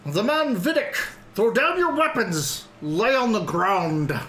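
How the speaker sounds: high, slow guard's bellow; dopey cartoon bellow. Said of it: "you hear a deep voice in the back".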